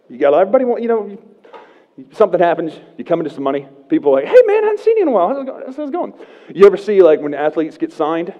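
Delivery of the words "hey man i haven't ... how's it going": much higher and fast, fake-chummy greeting; mock eager hanger-on; fake friendly buddy. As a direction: gush like a friend who smells money. Fair-weather friend greeting newly rich.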